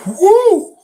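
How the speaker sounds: very high, loud hollering woo; joyful whoop. Real, not acted. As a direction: let out a wild whoop of release. Hollers after calming himself.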